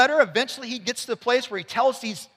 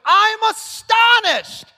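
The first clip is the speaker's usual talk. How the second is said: high, slow, loud astonished Paul; shocked Paul; 10 semitones higher, 5 dB louder, much slower than usual. Retold or imitated. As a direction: act out Paul's shock, drawn out and big. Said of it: "That's what he's doing here".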